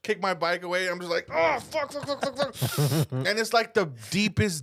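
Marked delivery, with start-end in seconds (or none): 1.3-3.1 high pained cursing, rapidly repeated; acted agony. Rider on the ground with cramps, high pained cursing. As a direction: re-enact cursing through a cramp, pitched high.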